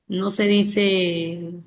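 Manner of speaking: ordinary talk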